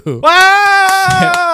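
high, loud sarcastic wow; mock amazed shout. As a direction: overdo the amazement. Mock amazement at date news.

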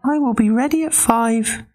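very high pig voice; squeaky little pig. Acted. Pig voice in story.

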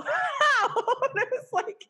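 high, laughing through it; can't stop laughing. Real, not acted. Retelling question, cracking up.

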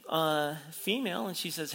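usual speaking voice

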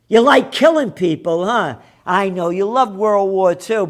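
loud, high accusing rant; scornful, heated shouting; angry taunt. Real, not acted. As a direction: throw the accusation in their faces. Berating NATO over wars.

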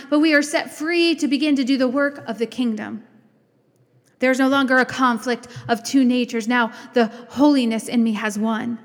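usual speaking voice

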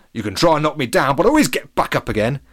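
put-on lightly drunken defiance; Freddie Flinders. A narrator's reading. Cider drinker defies whoever knocked him, slurring. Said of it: "he slurred".

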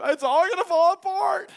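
high, loud, panicky wail; mock panic. Preacher mocking the panicked worrier, high and loud. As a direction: ham up a frantic worrier. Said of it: "the worry of".

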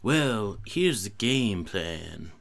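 slow drawled Texan drawl, lightly done; a lazy Texan drawl trailing to a whisper; Matthew McConaughey impression. Lightly done Texan drawl playing Matthew McConaughey for the game. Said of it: "let me see Matthew McConaughey here".

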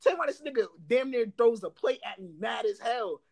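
ordinary delivery, nothing marked

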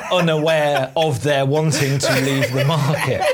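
normal talking voice

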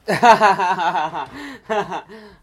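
high rising giggle; a bright nervous giggle; nervous laugh. Real, not acted. Nervous laugh at interview start.

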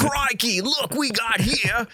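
high, drawn-out, hammed-up Australian accent; Steve Irwin, Australian accent. Host retries the New Zealand accent, lands on mock Australian. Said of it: "That's Steve Irwin".

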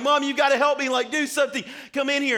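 high, loud whiny plea; desperate plea to mom. Retold or imitated. Little brother begging mom to break up the fight, high whiny plea. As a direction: beg mom to come like a crying kid.

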